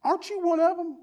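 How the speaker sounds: put-on high, quiet girl's accusing question; accusing little girl. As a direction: mimic a girl pointing him out. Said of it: "backed down by a little girl 'cause she said".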